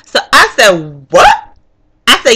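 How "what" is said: sudden high-pitched yelp; shocked. Retold or imitated. Voter told she isn't registered, shocked. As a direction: squeak it out in disbelief.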